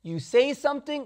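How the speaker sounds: ordinary delivery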